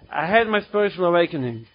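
ordinary delivery

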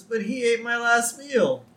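high-pitched, feeble protest; plaintive whine. Retold or imitated. Prisoner whose last meal was eaten, plaintive. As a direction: whine it like a wronged little man.

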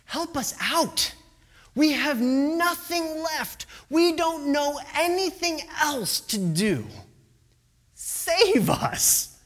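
high-pitched, drawn-out begging for rescue; acted cry for help; desperate plea. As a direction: plead as if out of options. Desperate disciples pleading with Jesus.

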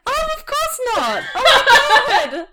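high, loud, laughing exclamation; delighted outburst. Real, not acted. Co-host hearing Kendall isn't the firstborn, delighted outburst. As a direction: burst out loudly, voice leaping up with laughter. Co-host reacts to friend's sudden realisation.

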